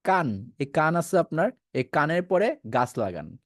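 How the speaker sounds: normal talking voice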